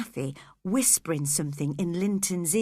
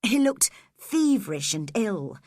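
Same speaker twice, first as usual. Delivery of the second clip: plain reading voice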